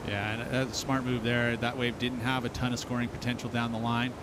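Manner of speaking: usual speaking voice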